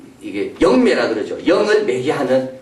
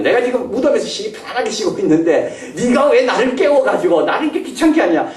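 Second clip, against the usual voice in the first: annoyed Samuel; 8 semitones higher than usual. Retold or imitated. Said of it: "이 말이죠".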